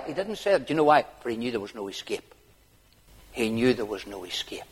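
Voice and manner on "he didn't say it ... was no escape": ordinary delivery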